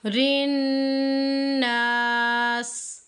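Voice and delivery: नास drawn out long; slow recitation. Shown as a demonstration. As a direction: stretch नास, not नस. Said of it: "teacher recites the word". (नास को खींच कर)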